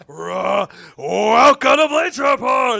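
booming, slow announcer roar; fighting game announcer. Pretend. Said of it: "I'd have to do some roaring tone".

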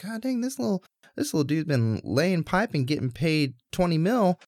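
high, stunned "God dang"; mock shock at the money; amazed exclamation. Insurers react to stud fee.

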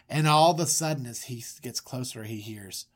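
usual speaking voice

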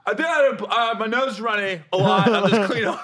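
put-on busted teen trying to explain away the mess; flustered excuse. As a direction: scramble for a lie, caught red-handed. Retold own flustered excuse to mom.

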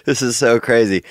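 helpless real laughter breaking through the words; laughing. Real, not acted. Host cracking up at the caller's swan story, laughing.

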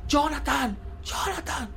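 high-pitched imitation of a calling mother; high, drawn-out mock call. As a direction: mimic a mother calling her child's name from afar. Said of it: "Y mamá".

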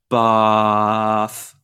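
"bath" held long on one steady vowel; slow, level, elongated "bath"; drawn out. Shown as a demonstration. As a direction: stretch "bath" without moving the vowel. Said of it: "if I say the word bath long".

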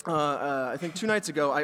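usual speaking voice

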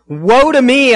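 high, loud cry of dismay. Acted. Preacher reading the prophet's lament to the congregation, crying out. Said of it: "I cried".

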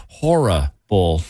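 "Horrible" slowed and pulled apart; drawn-out, broken "Horrible"; botched pronunciation. Real, not acted. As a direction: stumble through "Horrible" in slow chunks. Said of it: "I said it wrong again".

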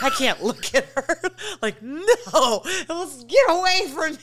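high, laughing wail of shame; mock horror. Seeing own reflection after masturbating, hammed-up horror. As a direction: recoil from it, half laughing, half wailing.

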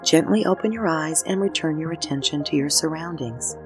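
calm unhurried meditation voice with pauses; guided meditation. Acted. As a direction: guide them gently through each breath. Leads a breathing meditation.

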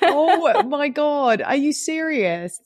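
slow, high disbelief; shocked. Real, not acted. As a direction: drag out the shock. Reacting to shocking challenge.

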